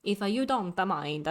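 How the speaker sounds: slow, hammed-up Disney French accent; mock French accent. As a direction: lay on a cartoon French accent slowly. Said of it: "The mock accent would be even funnier".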